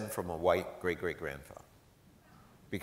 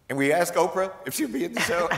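laughing it out, higher and louder; 9 semitones higher, 8 dB louder than usual. Real, not acted. Retelling asking [name] about [name], laughing. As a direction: barely get it out for laughing.

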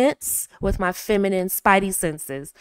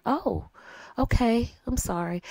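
softly lowered apology; softer, lower voice; 8 dB quieter than usual. Shown as a demonstration. Wife calming her stressed husband, soft and low. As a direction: drop your voice and soften. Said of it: "lower your voice ... get a little bit softer".

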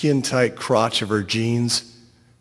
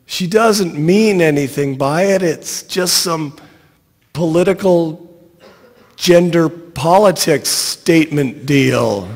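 raised, high-pitched calling out; yelling; 4 semitones higher, 4 dB louder than usual. A narrator's reading. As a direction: yell an excuse after a departing crowd. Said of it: "I'm yelling".

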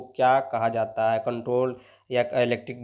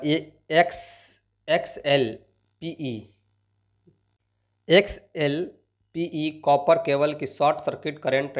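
slow dictation; 3 semitones higher, much slower than usual. Shown as a demonstration. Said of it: "reading the question out slowly".

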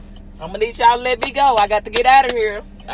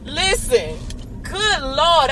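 ordinary delivery

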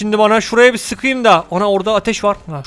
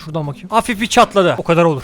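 usual speaking voice

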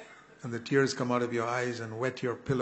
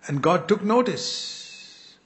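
higher, louder and slow, punching took; emphatic climax; 6 semitones higher, 7 dB louder, much slower than usual. Acted. Preacher telling the congregation God saw, punching took. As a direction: land it like a revelation.

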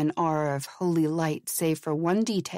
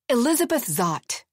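a louder, higher bark of her name; 5 semitones higher, 3 dB louder than usual. A narrator's reading. Elizabeth answering the confused producer, a loud bark. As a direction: fire her name at him. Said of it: "she barked back".

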